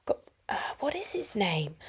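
a whispered aside while trying to recall; whispered to herself. Real, not acted. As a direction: whisper it, searching your memory. Whispering to herself about a name.

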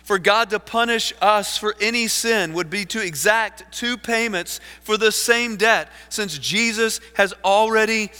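high, loud, urgent pulpit delivery; fervent preaching. Acted. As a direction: drive the argument home at full force. Preaches quotation at full force.

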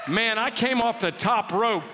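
normal talking voice

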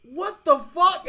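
loud, high, drawn-out comic outrage; exasperated disbelief. Retold or imitated. Storyteller reliving seeing three take-home trays, loud exasperated disbelief. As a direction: play up the disbelief, slow and loud.

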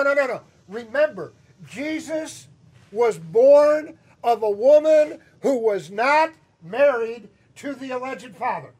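ordinary delivery

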